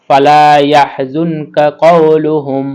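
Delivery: ফালা ইয়াহজুনকা কওলুহুম read slowly, every syllable even and clear; slow model reading. Shown as a demonstration. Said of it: "teacher models the full phrase".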